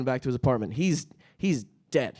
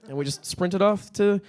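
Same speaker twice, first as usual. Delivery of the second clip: normal talking voice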